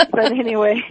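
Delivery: bright burst of laughter; laughing. Real, not acted. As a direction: laugh then carry on. Laughing about her braces.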